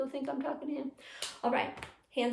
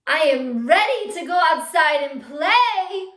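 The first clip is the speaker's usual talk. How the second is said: loud, high, eager shout; excited; 4 semitones higher, 13 dB louder than usual. Shown as a demonstration. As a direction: project it like a kid dying to go out. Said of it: "let's make our sentence be excited".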